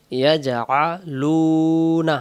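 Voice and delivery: drawn out joined syllables; slow recitation of joined letters. Shown as a demonstration. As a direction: blend the syllables slowly. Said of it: "লাম পেশ লু".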